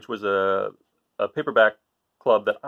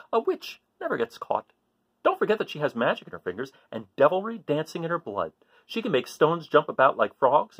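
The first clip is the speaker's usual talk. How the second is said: put-on lively read-aloud to children; story reading; 3 semitones higher than usual. A narrator's reading. Teacher reading to kids, lively storytelling voice.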